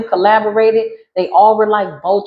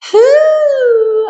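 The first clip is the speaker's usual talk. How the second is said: loud high whew; 6 dB louder than usual. Real, not acted. Exclaiming after a joke.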